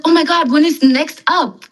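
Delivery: pleading for the next high; excited quote. Retold or imitated. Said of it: "you're like".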